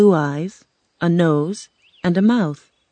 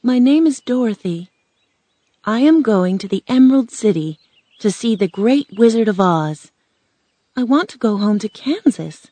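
put-on higher-pitched, earnest girl; a simple girl's voice, pitched up; earnest young Dorothy; 4 semitones higher, 3 dB louder than usual. Girl tells the scarecrow of her journey, earnestly.

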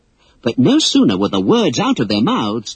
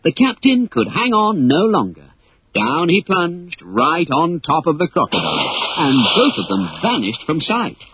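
ordinary delivery

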